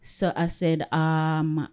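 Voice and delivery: usual speaking voice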